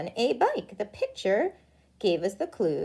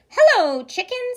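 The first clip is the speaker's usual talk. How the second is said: cheery duck greeting; 12 semitones higher, 6 dB louder than usual. Acted.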